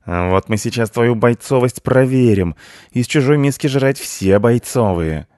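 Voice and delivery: high, loud cat threat; angry challenge. A narrator's reading. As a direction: sneer at the intruder loudly. (злой громкий вызов)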